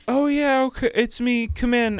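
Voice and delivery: chirpy customer voice. Retold or imitated. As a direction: imitate the customer answering happily way up high. Retold customer answering buzzer.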